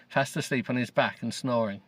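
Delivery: ordinary delivery, nothing marked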